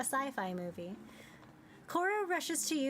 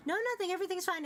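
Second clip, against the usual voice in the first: nervous character voice; 8 semitones higher, much faster than usual. Acted. Nervous professor exchange.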